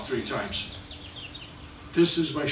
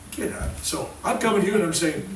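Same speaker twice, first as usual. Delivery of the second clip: ordinary talk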